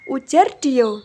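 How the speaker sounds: ordinary delivery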